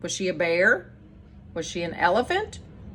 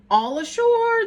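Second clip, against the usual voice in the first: high, louder sailors' call; shouted call; 12 semitones higher, 4 dB louder than usual. Acted. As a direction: call it out from the deck. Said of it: "the men shouted".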